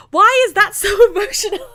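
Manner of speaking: high, loud wail breaking into laughter; tearful outburst. Real, not acted. Reader finishing the fic in tears, high emotional wail. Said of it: "tears, just tears all over my face".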